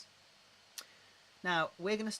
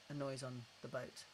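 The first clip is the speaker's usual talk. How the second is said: normal talking voice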